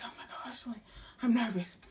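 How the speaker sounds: whispering "Oh my gosh, like, I'm nervous" under her breath; retold whisper of test nerves. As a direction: hush it so the examiner can't hear. Nervous behind the wheel at test.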